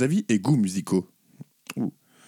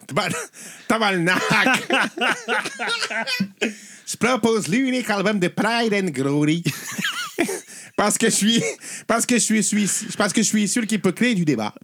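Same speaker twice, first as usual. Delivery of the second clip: a hammed-up Québécois accent, a bit higher; 6 semitones higher than usual. Reading a listener's letter to his cohost in mock Québécois accent. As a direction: swear tabarnak and read on in mock Québécois. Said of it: "je te laisse faire l'accent québécois".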